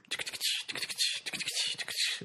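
rhythmic ticking rattle made with the mouth; imitated snake rattle in rhythm; rattlesnake rattle. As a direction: rattle out a crisp repeating beat. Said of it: "rattling out a rhythm".

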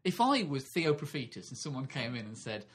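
ordinary delivery

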